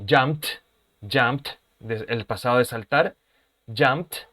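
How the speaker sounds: "jumped" ending in a crisp t; final t sound. Shown as a demonstration. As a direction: close "jumped" on a sharp t. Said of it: "con el sonido de una letra T".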